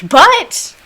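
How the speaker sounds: high, loud "but"; mock emphatic objection. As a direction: burst back in to reclaim the credit. Colonists' comeback after conceding.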